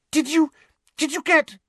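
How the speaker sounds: high, eager stammer; excited. A narrator's reading. Hermione tearing into the room with her letter, excited. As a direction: burst out with it, very high. Hermione bursts in, breathless with news.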